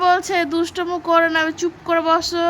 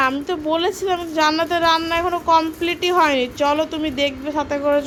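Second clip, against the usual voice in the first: usual speaking voice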